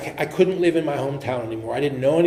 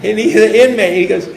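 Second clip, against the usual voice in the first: ordinary delivery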